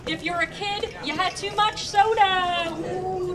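ordinary talk

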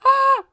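sudden high shriek of fright; startled scream. Real, not acted. Player shrieks at a sudden scare. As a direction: yelp as something jumps out.

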